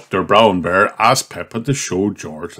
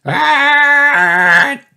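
high squeaky sneeze, a bit louder; 4 dB louder than usual. A narrator's reading. George sneezing, caught a cold. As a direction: sneeze like a small piglet. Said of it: "George cannot stop sneezing".

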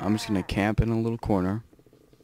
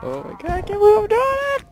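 ecstatic shout. Real, not acted. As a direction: yell it out as you finally pull it off. Winning the hard fight.

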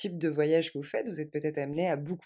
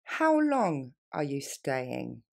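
how long are you staying stretched out, lower and slow; very slowly; 5 semitones lower, much slower than usual. Shown as a demonstration. Teacher modelling for students, very slow. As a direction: drag each word for the learner. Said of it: "Encore une fois plus lentement".

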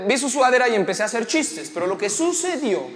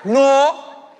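raised, louder, drawn-out no; angry heckler's refusal; 4 semitones higher, 8 dB louder than usual. Acted. Angry audience member answering the comedian, acted loud refusal. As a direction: shout down the comic with one word.

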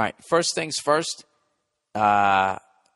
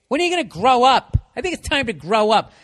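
higher, louder mocking scold of a grown-up; 10 semitones higher, 4 dB louder than usual. Comedian mocking people who nag about midlife, mocking scold. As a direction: whine it out like a preachy elder telling you to act your age. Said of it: "And if anybody says that".

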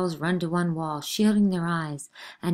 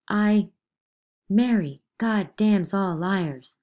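slow accusation; 3 semitones higher, 6 dB louder, slower than usual. Acted. Girl accuses slowly, louder.

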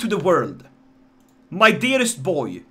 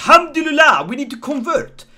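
louder and higher, a big joyful cry; excited cheer; 7 semitones higher, 7 dB louder than usual. Pretend. Streamer cheering the planned conversion, excited cry. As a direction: celebrate the decision out loud.

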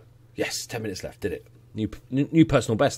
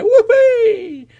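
high laughing whoop of joy; whoopee; 8 dB louder than usual. Real, not acted. Gamer cheering his side's goal, laughing whoop. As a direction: let out a giddy whoop.

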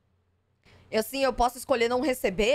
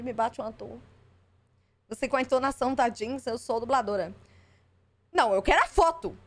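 normal talking voice

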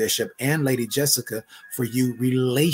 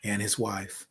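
intimate closing prayer. Acted. Closing prayer over the couple.

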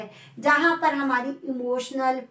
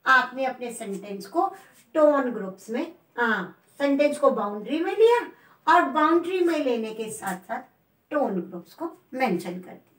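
ordinary talk, nothing marked

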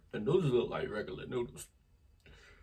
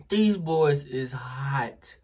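slow emphatic; 3 semitones higher, 8 dB louder, much slower than usual. Real, not acted. Spice hits hard.

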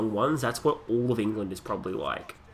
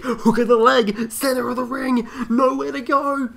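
very high, loud, stretched-out pin call; milk every move like a frenzied announcer; shouted wrestling commentary; 13 semitones higher, 8 dB louder, slower than usual. Pretend. Gamer calling his pin attempt, excited drawn-out shout.

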